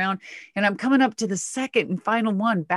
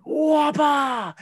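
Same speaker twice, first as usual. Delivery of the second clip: high-pitched truck landing noise; imitated truck impact; 7 semitones higher than usual. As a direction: voice the truck slamming into dirt. Imitating the truck hitting dirt.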